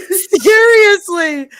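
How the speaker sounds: high, stretched out through real laughter; helpless laughing. Real, not acted. Storyteller teased about her pen pal, laughing hard. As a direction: wail it out while cracking up. Cracking up at friends' teasing.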